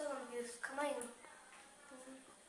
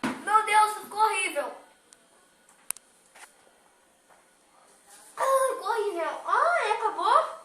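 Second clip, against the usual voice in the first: loud, higher disgusted cry; 6 semitones higher, 12 dB louder than usual. Real, not acted. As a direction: cry out at the awful taste. Disgusted reaction after tasting.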